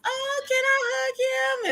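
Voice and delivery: put-on an admiring lady asking to hug the boy, high and cooing. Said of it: "the ladies".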